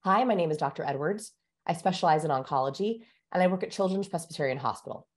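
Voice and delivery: usual speaking voice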